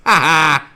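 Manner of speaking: loud, high triumphant "ha ha"; gloating laugh. Acted. Host winning the teeth contest, loud gloating laugh. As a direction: crow out a mock victory laugh.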